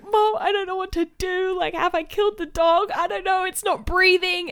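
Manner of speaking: high-pitched whimpering plea, hammed up; mock tearful panic. Retold panicked phone call to mum, mock sobbing. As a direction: act out a distraught, wailing call. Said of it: "I'm, like, literally losing it".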